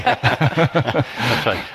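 chuckling agreement; laughing. Real, not acted. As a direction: laugh through the words.

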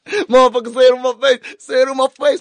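very high, loud mock challenge; over-excited outburst. Host carried away riffing on charges, over-excited. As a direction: yell it high, hyped up and playful. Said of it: "got a bit excited over there".

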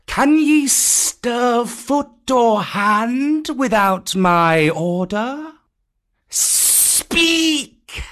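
slow, high commanding snake; Kaa the python. Acted. Kaa demanding an answer from the monkeys, slow high command. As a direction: stretch each word over the cowed listeners.

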